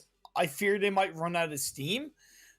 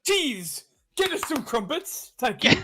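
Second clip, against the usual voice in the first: high mock-posh British, ordering the butler; posh British accent; 7 semitones higher than usual. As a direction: play a hammed-up British aristocrat. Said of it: "Or I could do a posh one".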